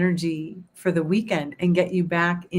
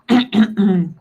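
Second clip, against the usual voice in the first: loud, rough throat clear; 2 semitones higher, 7 dB louder than usual. Real, not acted. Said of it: "choking here".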